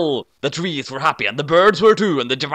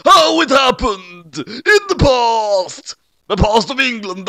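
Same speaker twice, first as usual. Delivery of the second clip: higher, louder and slow, near a cry; dramatic reveal; 7 semitones higher, 5 dB louder, slower than usual. Acted. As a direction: draw out the ominous past.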